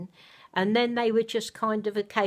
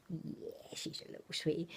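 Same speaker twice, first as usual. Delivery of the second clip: whispered, much softer; hushed whisper; 11 dB quieter than usual. Real, not acted. Mother whispering tenderly beside the sleeping baby doll.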